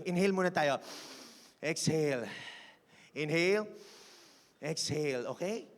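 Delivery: soft, low, unhurried breath cues; gentle breathing instruction; calm breathing guide. Real, not acted.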